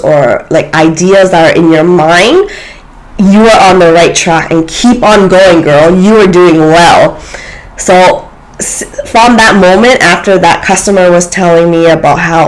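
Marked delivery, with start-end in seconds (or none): none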